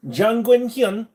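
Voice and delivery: high, loud, slow mock Korean name; hammed-up Korean-accented shout. Announces made-up Korean name.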